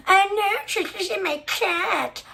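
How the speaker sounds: mocking high-pitched gross voice; put-on high mocking impression; Beta. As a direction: exaggerate a weird accent, slow and whiny, to ridicule. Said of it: "weird, gross accent and her gross voice".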